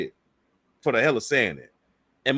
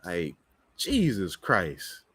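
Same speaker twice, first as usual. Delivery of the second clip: exasperated mutter; 9 semitones lower, 6 dB quieter, much slower than usual. Real, not acted. Exasperated at the video.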